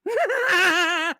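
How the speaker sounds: high villain cackle; manic, throat-straining fake laugh; Joker laugh. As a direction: cackle like a mad clown. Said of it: "broke my throat to do the laugh".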